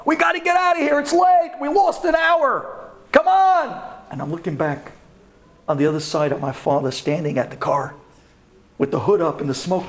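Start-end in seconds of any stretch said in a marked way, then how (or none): 0.0-3.8 high, loud impatient yelling; teen friends shouting for him to hurry up; friends calling out. Retold or imitated. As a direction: call him over from across the street. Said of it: "My friends were screaming, calling".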